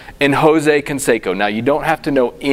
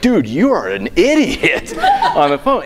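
laughing; 7 semitones higher than usual. Retold or imitated. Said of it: "They're like".

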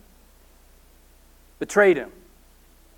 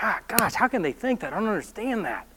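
muttered exasperation; 11 dB quieter than usual. Retold or imitated. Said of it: "you're like".

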